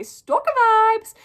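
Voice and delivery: slow, high, loud playful alarm; dramatic verdict. Acted. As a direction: draw it out like a scandalised gasp. Comic verdict on stalker messages.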